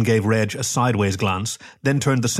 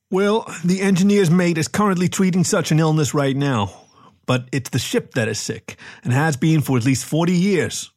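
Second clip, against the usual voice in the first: lightly voiced captain, somewhat higher; explaining captain; 6 semitones higher than usual. A narrator's reading. Captain telling the medic the ship is sick, lightly voiced explanation. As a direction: explain the odd case with mild emphasis.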